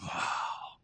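whispered wow of wonder; awed thought. Retold or imitated. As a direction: let it out in a soft hush. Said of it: "I think".

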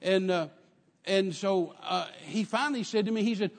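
ordinary delivery

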